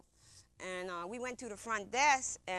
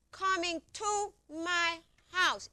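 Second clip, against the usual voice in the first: higher, drawn out word by word; slow, emphatic repeat; 7 semitones higher, 4 dB louder, slower than usual. Retold or imitated. Telling questioners she was only coming home, slow and emphatic. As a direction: spell it out slowly, insistently.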